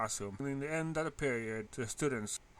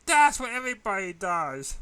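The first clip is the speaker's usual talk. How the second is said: retort; 6 semitones higher, 10 dB louder than usual. A narrator's reading. Student's retort.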